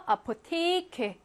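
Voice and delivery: "아포테이케" with "테이" held long; slow German "아포테이케", long third syllable; long vowel. Shown as a demonstration. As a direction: stretch the stressed vowel in "아포테이케". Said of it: "길게 발음하고요".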